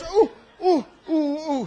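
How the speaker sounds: hammed-up God recoiling with ooh sounds; comic wincing ooh from God; mock pained groans. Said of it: "God goes".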